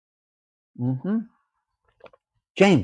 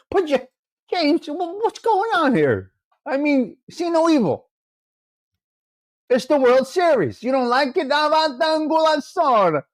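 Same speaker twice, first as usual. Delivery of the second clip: high squeaky put-on voice, mock scolding a viewer; high mock outrage; 7 semitones higher than usual. To a chat viewer who doubts the World Series, high squeaky mock outrage. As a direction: squeak out the disbelief like a cartoon.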